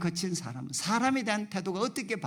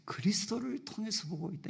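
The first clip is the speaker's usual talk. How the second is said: hushed whisper; 2 semitones higher, 8 dB quieter than usual. Retold or imitated. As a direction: drop to a whisper for the second half. Same words repeated, fading to whisper. (천천히 시작해 뒷부분은 속삭이듯)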